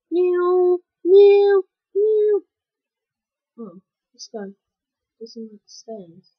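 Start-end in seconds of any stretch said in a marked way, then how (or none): 0.1-2.4 loud high meows; loud high cat meows. Pretend. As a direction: meow like a cat. Random cat noises.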